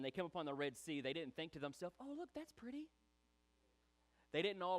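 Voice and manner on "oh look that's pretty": high, soft, airy tourist cooing; mock admiration. Israelites admiring the Red Sea, mock-dainty wonder. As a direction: play a delighted sightseer, high and light.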